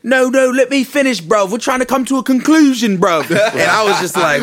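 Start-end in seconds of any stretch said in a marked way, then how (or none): 0.0-3.3 high, loud mock London roadman voice; mock UK accent. Clubhouse Canadians faking UK accents, mock British. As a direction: ham up a British accent, arguing. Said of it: "Canadian slash U K group".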